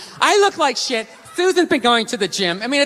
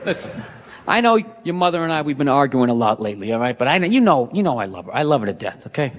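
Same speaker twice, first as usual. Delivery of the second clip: much lower, hushed man-to-man confession; confiding boyfriend; 10 semitones lower, faster than usual. Retold or imitated. Telling her son about his mother: low, hushed confession. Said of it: "the maddest she ever got".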